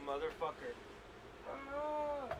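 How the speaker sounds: ordinary talk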